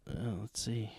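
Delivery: breathy whisper under his breath; whispered aside. Real, not acted. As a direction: mutter to yourself. Muttered aside to himself.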